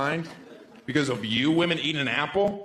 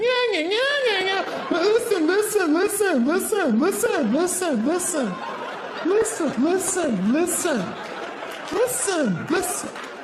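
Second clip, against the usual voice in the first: much higher, whiny repeated listen; mock nagging partner; slower than usual. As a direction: nag in a squeaky high voice.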